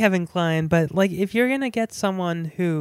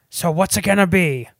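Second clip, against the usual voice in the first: imitating Pacino; 6 dB louder, faster than usual. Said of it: "Pacino's like".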